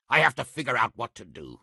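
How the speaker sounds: ordinary talk, nothing marked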